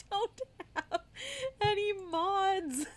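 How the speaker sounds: high, laughing through the words; giggling at herself. Real, not acted. Laughing while retelling the mistake.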